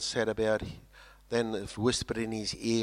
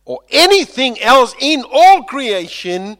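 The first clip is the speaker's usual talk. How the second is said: high, loud cry; soaring proclamation; 13 semitones higher, 18 dB louder than usual. Acted. Preacher crying out Paul's promise to the church. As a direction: lift it up to the rafters. Climax of Romans quote.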